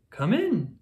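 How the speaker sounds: Owl welcoming. Acted. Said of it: "says Owl".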